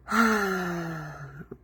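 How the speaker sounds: long, airy exhale; long sigh. Real, not acted. Coach pausing to find the right word, long sigh. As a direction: let a soft breath out slowly.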